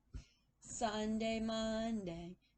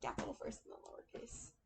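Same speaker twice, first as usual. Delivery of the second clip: whispering; 6 dB quieter, faster than usual. Real, not acted. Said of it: "Oops, I did that one backwards".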